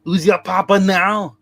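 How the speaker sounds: slow, loud mock Arnold Schwarzenegger accent. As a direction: do Arnold taunting his enemy. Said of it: "I can't do his accent".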